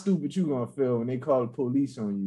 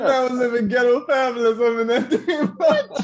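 loud high laughing line; 11 semitones higher, 8 dB louder than usual. Real, not acted.

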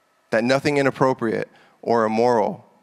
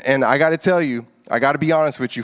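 usual speaking voice

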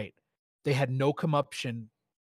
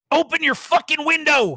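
furious scream, much higher and louder; angry man pounding on the car window, screaming; 9 dB louder than usual. A narrator's reading. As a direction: scream it through the glass at her. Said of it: "he screamed".

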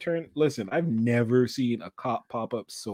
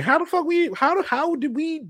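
high-pitched, stammering disbelief; incredulous; 13 semitones higher than usual. Acted. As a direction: marvel at it, voice pitched way up. Quoting himself in disbelief.

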